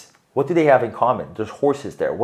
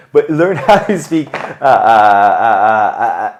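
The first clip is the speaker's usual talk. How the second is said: laughing; 10 dB louder, slower than usual. Real, not acted. Laughs while searching for a word.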